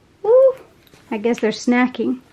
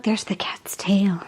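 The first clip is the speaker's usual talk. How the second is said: whisper; 3 semitones lower than usual. Real, not acted. Spotting the hidden cat.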